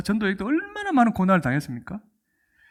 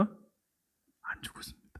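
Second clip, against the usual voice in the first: slow whispered answer; dramatic whisper; 18 dB quieter, much slower than usual. Real, not acted. Preacher answering his own question in a slow whisper. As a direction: hush it, slow, for effect. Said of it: "emphatic whispered answer".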